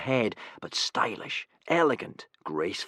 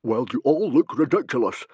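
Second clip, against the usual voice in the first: louder gruff scoff; bluster; 5 semitones higher, 6 dB louder than usual. A narrator's reading. Stoic scoffing at Gobber's new curls, blustering. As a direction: bluster at the silly hair. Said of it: "blustered Stoic".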